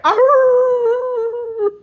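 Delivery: a high, silly animal howl; mock howl. She howls for her man on command, high and silly. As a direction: throw out a hammed-up wolf howl. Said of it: "So she's like".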